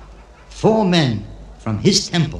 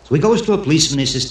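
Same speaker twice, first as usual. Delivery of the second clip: ordinary talk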